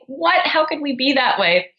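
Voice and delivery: high, put-on innocent parents; mock parents. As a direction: mimic their wide-eyed denial. Parents mocked, feigning innocence.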